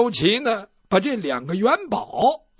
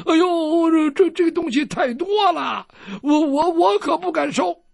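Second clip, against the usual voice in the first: put-on higher, stammering old woman refusing the gift; a lightly voiced granny, overwhelmed; flustered old lady; 8 semitones higher, 4 dB louder than usual. Old lady protesting the silver is too much, flustered higher voice.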